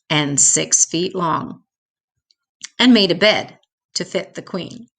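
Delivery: ordinary delivery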